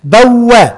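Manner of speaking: teacher stresses the doubled waw in بوأ; press the waw. Shown as a demonstration. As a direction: lean hard on the waw of بوأ. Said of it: "بندوس عليها".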